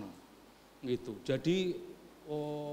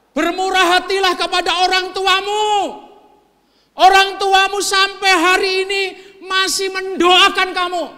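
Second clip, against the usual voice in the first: high, loud fervent plea; preaching at full force; 13 semitones higher, 17 dB louder than usual. Acted. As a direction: plead from the pulpit with full force.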